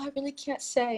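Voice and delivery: high-pitched mock helpless damsel; fake damsel in distress. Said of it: "in a very fake damsel in distress type of voice".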